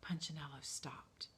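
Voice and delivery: usual speaking voice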